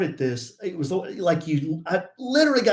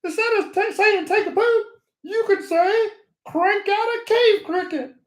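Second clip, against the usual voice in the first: much higher, silly puppet voice; high squeaky toilet paper puppet voice; 4 dB louder than usual. Retold or imitated. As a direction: put on a high cartoon puppet voice. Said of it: "he kinda talks like this".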